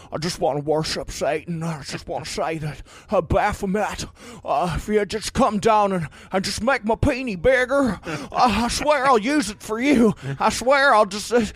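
Jimmy Stewart impression. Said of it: "That's my It's my Jimmy Stewart impression".

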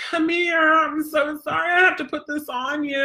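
high, pitying apology to the dog; mock sorry to the dog. Owner apologizing to her dog for the collar, high and pitying. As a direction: coo like you feel guilty for hurting him. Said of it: "I'm like".

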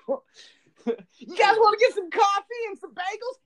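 high, loud mock rocker voice; mock your friend's rock-singer yell; imitating a rock-star yelp. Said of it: "you go like".

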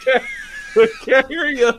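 high, strained call over a distance; shouting from far away. Retold or imitated. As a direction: yell back across a big gap. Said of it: "And Shazam's like".

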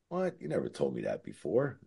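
stunned, voice jumping up on what; retold shock. Learning Kurt can't hear.

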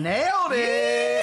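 high, drawn-out celebratory cheer; stretched, elated holler; triumphant shout. Real, not acted. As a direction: whoop it out for a right answer. Celebrating a correct answer.

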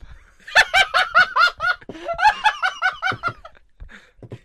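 long, very high cackle; shrieking laugh at the punchline. Real, not acted. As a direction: burst into loud helpless laughter. Riddle answer revealed as gum.

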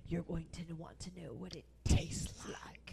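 slow, low whisper; hushed, drawn-out tease; seductive whisper. Acted. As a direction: whisper it slowly to entice. Acting out a seductive line.

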